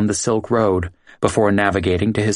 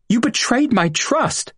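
mock yell of betrayal, pitched way up; narrated yell. A narrator's reading. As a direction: Ross accusing his friend of betrayal, high mock yell. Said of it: "he yelled".

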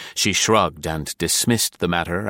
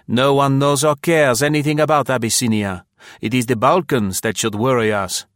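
dismissive Frenchman; 5 semitones higher, 4 dB louder than usual. Acted. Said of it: "was the response".

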